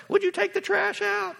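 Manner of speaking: high-pitched mock nagging; put-on high voice, pestering; nagging wife. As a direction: imitate a wife asking yet another time. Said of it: "she's asked me fifteen times".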